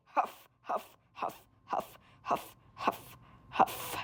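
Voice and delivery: unvoiced panting huffs; soft gasping breaths; breathless panting. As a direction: pant as if running hard. Running, out of breath.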